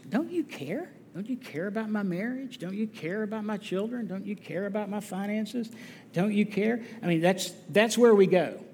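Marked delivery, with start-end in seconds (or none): 0.1-6.8 much softer, plaintive questions to God; whisper-soft complaint of a worried believer; quietly aching don't you care; hurt plea. Acted. Believer's anxious prayer voiced.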